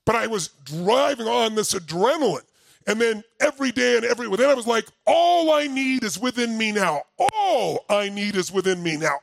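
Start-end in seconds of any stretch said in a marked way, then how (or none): 5.1-7.0 shouting the mantra mid-run; shouted incantation. Retold or imitated. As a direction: proclaim it into the dark like a battle cry. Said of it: "I would shout it".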